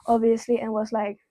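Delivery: usual speaking voice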